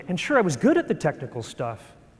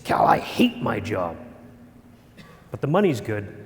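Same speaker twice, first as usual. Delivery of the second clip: glum friend; 7 semitones lower than usual. Retold or imitated. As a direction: drag it out like you're worn down. Friend Kim voiced glumly.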